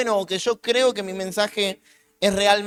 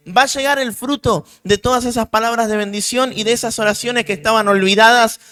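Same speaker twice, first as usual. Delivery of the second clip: louder, rising preaching climax; predicación a pleno pulmón; 3 semitones higher, 10 dB louder than usual. Real, not acted. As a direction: proclaim the harvest with full force. Predicación a todo volumen. (predicando con toda la fuerza)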